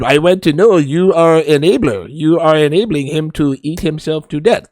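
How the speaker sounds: put-on Iranian accent with a Texas twang; blunt doctor in an Iranian-Texan accent; Doctor Now. Doctor warning a patient's enabler, straight-up Iranian-Texan accent. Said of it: "there's my impression of Doctor Now".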